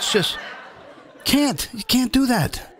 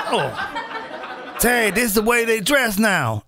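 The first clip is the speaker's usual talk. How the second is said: mock son reply; 2 semitones higher than usual. Son's reply, laughing.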